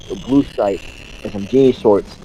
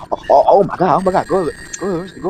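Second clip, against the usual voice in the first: higher, flustered teacher voice; panicked teacher; 6 semitones higher, much faster than usual. Retold or imitated. Teacher shocked at the accident, a touch of mock panic.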